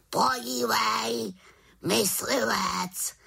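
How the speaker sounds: high, drawn-out raven croak; krákavý havran. A narrator's reading. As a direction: croak it out slowly like an excited raven. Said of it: "zakrákal po chvíli Havran Abraxas". (zakrákat protáhle jako havran)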